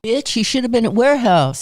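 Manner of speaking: loud, playful telling-off; mock scolding. Host ribbing guest about where he queued, loud mock scolding. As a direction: tease them with a loud pretend rebuke. Joking scolding, then just kidding.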